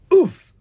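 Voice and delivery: short, high, loud oof; winded oof. A narrator's reading. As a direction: impact grunt with air knocked out. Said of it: "Air puffed from Ella's and Owen's stomachs".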